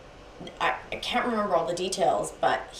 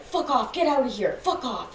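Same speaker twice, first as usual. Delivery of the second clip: higher-pitched mild mock anger; retold angry swearing; 6 semitones higher than usual. Boyfriend sending a child away, mildly angry. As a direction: imitate his irritable dismissal, voice raised in pitch. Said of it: "swearing at me".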